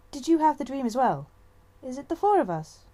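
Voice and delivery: put-on excited young she-cat asking; eager questions. A narrator's reading. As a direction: ask it in an excited rush.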